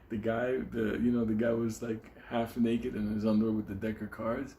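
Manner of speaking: ordinary delivery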